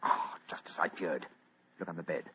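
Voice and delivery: a grim whispered aside. Acted. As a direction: breathe it out under your breath. Detective dreads what he finds.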